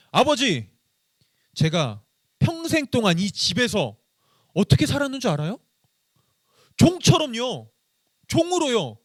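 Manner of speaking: high, hurt protest to father; bitter complaint. Retold or imitated. 아버지에게 서운함을 쏟아내는 첫째 아들, 높고 억울하게. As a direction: spit out years of grievance, rising high. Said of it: "첫째 아들은 아빠한테 이렇게 얘기하고 있었던 거예요".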